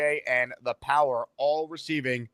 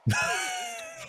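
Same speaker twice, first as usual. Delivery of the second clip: burst of laughter; 6 dB quieter than usual. Real, not acted. Surprised by jersey question.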